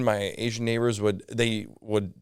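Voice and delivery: normal talking voice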